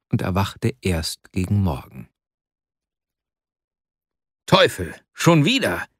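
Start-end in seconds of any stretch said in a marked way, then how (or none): 4.5-5.9 sharp exclamation on waking; genervter Ausruf. A narrator's reading. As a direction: exclaim it in annoyance, higher. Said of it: "rief er aus". (verärgert ausrufen, mit höherer Stimme)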